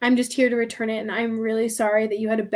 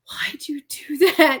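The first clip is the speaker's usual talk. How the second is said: whispered disbelief; 3 semitones higher, slower than usual. Retold or imitated. Friend Sione reacting on the phone.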